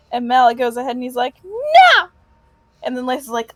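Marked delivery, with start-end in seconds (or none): 1.4-2.1 defiant shout. Acted. Malik refuses the party.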